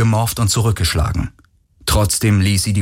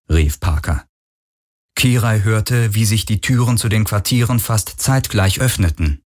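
ordinary delivery, nothing marked

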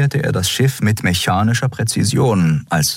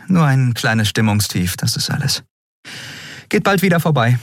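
lightly voiced woman, higher, playing down her mood; Endriel brushing it off; 7 semitones higher than usual. A narrator's reading. Captain reassuring young Miko, lightly voiced. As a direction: shrug off the worry with a thin smile.

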